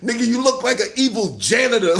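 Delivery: usual speaking voice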